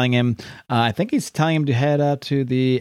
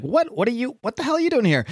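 high, stunned disbelief; shocked outburst; 10 semitones higher than usual. Retold or imitated. Captain America seeing the reporter turn villain, shocked high outburst. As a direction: blurt it out in shock.

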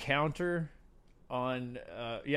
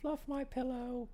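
high, soft, whiny woman voice; weak whiny bedridden; 10 semitones higher, 11 dB quieter than usual. Retold or imitated. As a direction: fluff my pillow like a weak invalid. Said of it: "that was my woman voice".

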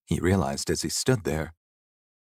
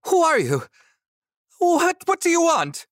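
very high, loud, frightened squeal; high-pitched shout; 6 dB louder than usual. Acted. Terrified young man challenging a ghost, high-pitched shout. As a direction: shout it in an embarrassingly high, stammering voice. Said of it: "he shouted in an embarrassingly high-pitched voice".

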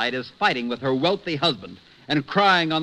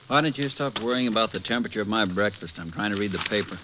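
grumpy husband. Acted. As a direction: brush her off from behind the paper. Husband grumbling behind newspaper.

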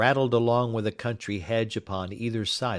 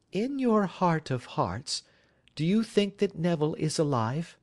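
high, earnest woman pleading for truth; wife begging Holmes for an honest answer; anxious wife; 8 semitones higher than usual. Acted.

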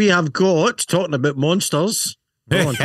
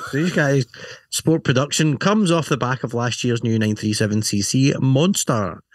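usual speaking voice

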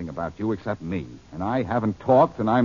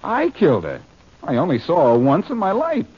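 higher, indignant denial; incredulous protest; 6 semitones higher, 3 dB louder than usual. Acted. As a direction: throw the accusation back in disbelief. Accused of murder, protests.

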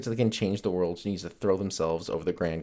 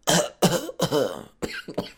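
forced cough that turns real; fake cough; 8 semitones higher, 4 dB louder than usual. Shown as a demonstration. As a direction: cough on purpose, loudly. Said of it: "I hate when I faked it and I got a real one".

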